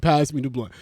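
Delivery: normal talking voice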